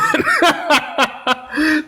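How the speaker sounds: high burst of laughter. Real, not acted. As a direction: laugh at own joke. Joke about DNC payment.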